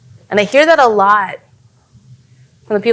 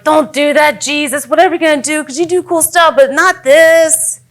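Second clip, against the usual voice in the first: alarmed protest; 6 semitones higher than usual. Acted. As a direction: protest in disbelief, stretching the last words. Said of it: "and they're like".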